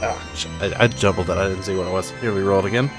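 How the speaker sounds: ordinary delivery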